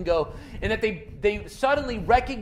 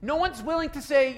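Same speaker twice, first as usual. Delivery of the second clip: normal talking voice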